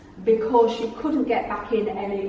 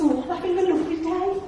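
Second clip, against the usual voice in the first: higher, chirpy Midlands lady; lightly voiced Julie Walters; 7 semitones higher, faster than usual. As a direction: play her as delighted and chatty.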